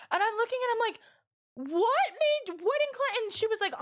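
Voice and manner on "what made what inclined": high-pitched retold disbelief; incredulous. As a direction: re-enact baffled disbelief, pitch shooting up. Retold disbelieving question to coworker.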